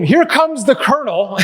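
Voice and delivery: high, excited child's mock yell; childish yell. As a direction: shout it as an eager kid. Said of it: "and I would scream".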